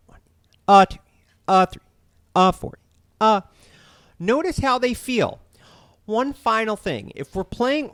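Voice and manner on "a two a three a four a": loud, high "a", numbers hushed between; accented uhs. Shown as a demonstration. Drum teacher counting accents on the uhs, stress on A. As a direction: punch each "a" and swallow the numbers. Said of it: "accent on the uhs".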